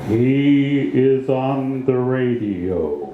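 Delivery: put-on slow, measured old-radio poet voice; poetry voice. Shown as a demonstration. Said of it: "He spoke in this, that poetry voice".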